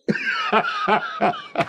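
very high cackling laughter; delighted laugh. Acted. Father laughs at news of the suitor, delighted. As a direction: burst into high, gleeful laughter.

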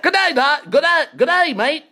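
high, loud, repeated Australian greeting; broad comic Australian; Australian accent. Retold or imitated. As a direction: ham up the Australian hello. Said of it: "What happened to your Australian accent?".

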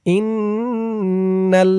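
ইন্নাল with a long humming double n; correct pronunciation demo. Shown as a demonstration. Teacher breaking words down, ইন্নাল chanted with drawn-out n. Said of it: "সঠিক উচ্চারণ করে বলে দিব".

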